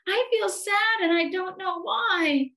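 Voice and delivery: high, forlorn little voice; plaintive and high, heaving a sigh; sighing sadly. A narrator's reading. Sad Eddie confides in his teddy, sighing. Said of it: "with a great big sigh".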